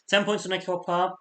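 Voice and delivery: hiccup jolts the voice. Real, not acted. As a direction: read the votes while hiccuping. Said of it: "This is terrible".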